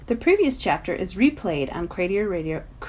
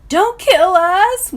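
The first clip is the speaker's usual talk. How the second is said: slow, high, loud frightened wail; 11 semitones higher, 10 dB louder, much slower than usual. Acted. Mac pleading with the armed stranger, wailing high and slow. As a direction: beg for your life, dragging it out. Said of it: "wails Mac".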